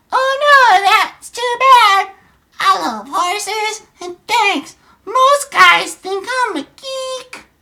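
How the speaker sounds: very high, slow put-on girl voice; girl's chat voice. Streamer as Sarah chatting to Donnie, high slow falsetto. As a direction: draw it out in a loud falsetto. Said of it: "forgive my voices".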